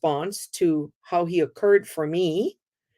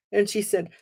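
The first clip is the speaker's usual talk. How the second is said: ordinary talk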